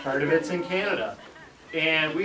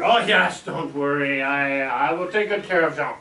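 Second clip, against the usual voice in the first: French-Canadian neighbor reassuring, loud at first; thick French accent; 5 dB louder than usual. Retold or imitated. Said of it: "very thick French accent".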